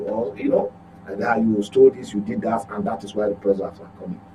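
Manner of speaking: ordinary talk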